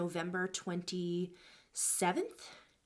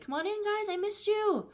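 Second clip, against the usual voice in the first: high, sing-song sweet greeting; overly sweet hello to the kids; sweet welcome; 13 semitones higher, 3 dB louder than usual. Retold or imitated.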